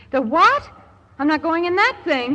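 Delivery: sudden jump up in pitch and volume, startled; alarmed surprise. Acted. Margot balks at the decompression chamber.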